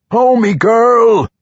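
high, loud and slow calling; desperate shout. A narrator's reading. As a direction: bellow her name in panic. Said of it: "he cried".

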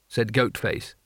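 ordinary delivery

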